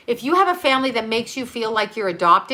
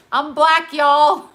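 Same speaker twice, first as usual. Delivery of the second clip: louder and higher, a proud shout-out; excited; 6 semitones higher, 7 dB louder, much slower than usual. Acted. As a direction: belt it out playfully. Punchy repeat of her joke.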